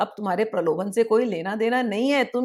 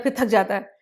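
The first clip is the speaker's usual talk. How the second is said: usual speaking voice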